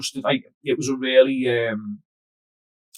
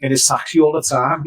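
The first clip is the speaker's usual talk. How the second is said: ordinary talk, nothing marked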